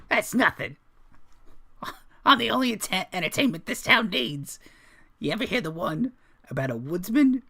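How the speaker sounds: high-pitched jester telling a joke. Acted. Jester boasting as the town's only entertainment, high comic voice. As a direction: pitch it way up, building to the punchline. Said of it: "he says".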